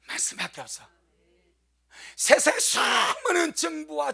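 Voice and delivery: impassioned preaching. Real, not acted. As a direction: press the point urgently on the congregation. Preaches at raised pitch.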